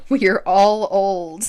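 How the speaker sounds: drawn-out words through laughter; amused, stretched-out laughing groan. Real, not acted. Laughing together about feeling old.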